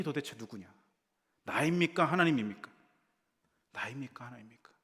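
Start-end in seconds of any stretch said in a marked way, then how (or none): 1.4-2.7 나입니까 and 하나님입니까 thrown out high and loud; 나입니까 하나님입니까 both high and loud; loud question. Shown as a demonstration. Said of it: "repeated softly". 3.7-4.7 나입니까 soft, 하나님입니까 in a whisper; 하나님입니까 whispered almost silent; whispered repeat. Shown as a demonstration. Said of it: "said loud first".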